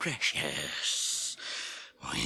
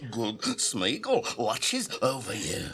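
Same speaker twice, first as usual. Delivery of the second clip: usual speaking voice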